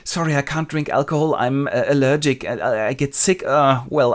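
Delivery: comic faltering reluctance; hesitant excuse. Acted. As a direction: wriggle out of it, then waver. Cassio nervously declines a drink.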